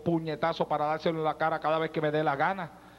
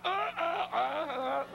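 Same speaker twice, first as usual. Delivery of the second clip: put-on higher, softer baby babble; imitating toddler babble; 10 semitones higher, 4 dB quieter, much slower than usual. Daughter answering her father by phone, soft imitated babble. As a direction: coo it like a tiny girl. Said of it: "¿Y qué, cómo está?".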